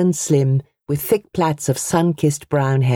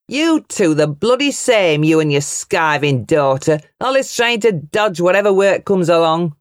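scolding bully. A narrator's reading. Uncle voiced in quarrel.

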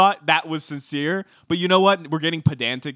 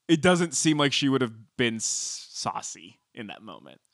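ordinary talk

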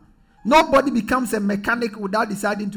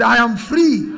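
loud, confident declaration; rousing, forceful call to echo; positive attitude; 2 semitones higher, 8 dB louder than usual. Shown as a demonstration. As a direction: proclaim it boldly, with conviction. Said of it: "With a positive attitude".

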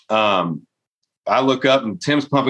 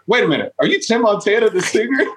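surprised recognition; 9 semitones higher than usual. Retold or imitated. Stranger recognizing Tim.

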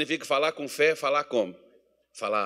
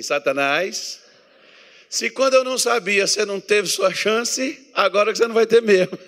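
louder mocking challenge, faster at the end; defiant taunt at Satan; 5 semitones higher, 6 dB louder than usual. Shown as a demonstration. Pastor having the church taunt Satan, defiant and mocking. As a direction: tell the devil he lost his chance. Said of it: "diga assim".

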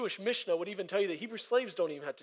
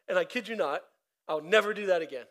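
ordinary delivery

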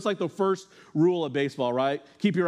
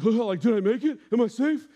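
clueless; 7 semitones higher, 3 dB louder than usual. Retold or imitated. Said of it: "I'm like".

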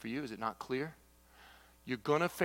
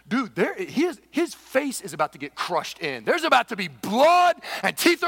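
excited alarm; 7 semitones higher, 11 dB louder than usual. Retold or imitated. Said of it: "I'm like sitting here watching this going".

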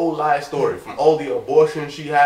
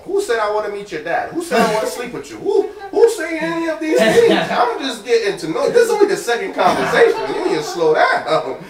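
higher, louder mock exasperation, laughing; exasperated rant; 8 semitones higher, 5 dB louder than usual. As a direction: rattle it off half-laughing, pushing back. Retold outburst at pushy woman.

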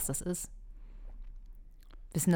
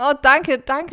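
louder, breathless mock-swooning thanks; gushing thanks; 8 semitones higher, 10 dB louder, much slower than usual. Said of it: "Und ich würde sagen". (schwärmerischer Dank)

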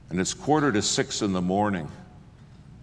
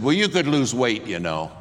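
higher, louder snappish comeback; retold snappy retort; 4 semitones higher, 4 dB louder than usual. Flight attendant snapping at the preacher, mock sharp. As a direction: snap it back at him. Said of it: "she exploded".

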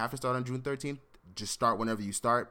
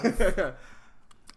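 high burst of laughter; 7 semitones higher, 5 dB louder than usual. Real, not acted. Big laugh after sing request.

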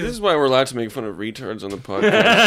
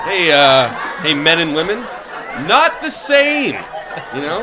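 put-on old borscht belt comic; 4 dB louder than usual. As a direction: play a cheesy eighties stand-up. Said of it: "a bad eighties comedian".

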